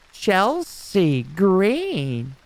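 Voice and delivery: slow drawn-out announcer call; ring intro. Retold or imitated. Imitating an announcer calling a wrestler down, drawn out. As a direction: stretch the name like a ring intro.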